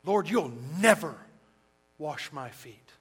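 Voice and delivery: loud, hammered NEVER, then drops; emphatic refusal. Retold or imitated. Peter commanding Jesus not to wash his feet: emphatic refusal. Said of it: "Screaming it at the top of their lungs".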